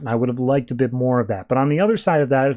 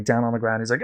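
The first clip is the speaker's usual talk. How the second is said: usual speaking voice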